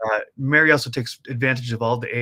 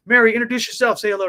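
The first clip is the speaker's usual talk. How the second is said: loud host cue; 9 semitones higher, 9 dB louder, faster than usual. Real, not acted. Host cueing guest on air.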